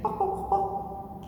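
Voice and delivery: high clucking hen noise; chicken clucking. Shown as a demonstration. Teacher shows kids a chicken sound, high clucks.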